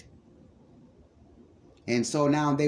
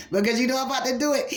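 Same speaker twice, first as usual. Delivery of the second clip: fast and higher; excited rush; 9 semitones higher, much faster than usual. Real, not acted. Preacher about to take off, excited fast rush. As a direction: race ahead, barely holding back. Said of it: "I'm about to take off".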